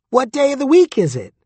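high, squeaky little critter. A narrator's reading. Durden's dick answering him, high squeak. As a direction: pipe it up high and cheeky.

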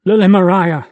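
ragged, loud cry of her name. A narrator's reading. The jilted lover calls after her, ragged cry. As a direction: cry out as she is torn away. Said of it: "Idomeneus cries in a ragged voice".

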